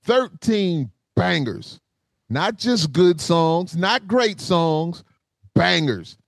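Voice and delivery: each phrase drawn out slowly; slow emphatic hype. Acted. Host sells the album to the listeners, slow emphatic hype. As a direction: stretch every word for emphasis.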